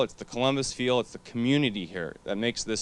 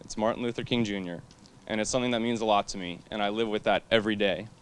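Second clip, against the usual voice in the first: usual speaking voice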